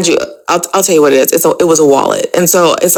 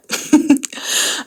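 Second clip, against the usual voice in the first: laugh; 11 semitones higher, 5 dB quieter than usual. Real, not acted. Laughs at finding the keys.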